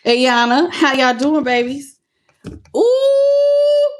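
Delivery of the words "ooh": high delighted ooh; sudden high-pitched ooh of excitement; excited squeal. Real, not acted. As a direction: squeal with glee at the card. Excited tarot card reveal.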